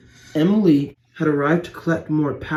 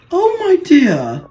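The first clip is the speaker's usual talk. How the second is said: high and loud, a startled cry of concern; surprised cry; 12 semitones higher, 6 dB louder, slower than usual. Acted. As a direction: gasp it out in shocked dismay. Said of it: "she cried".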